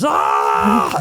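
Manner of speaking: short loud imitated laugh burst; mock roaring laugh. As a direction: bark out the friend's big laugh. Retelling friend's roaring laugh.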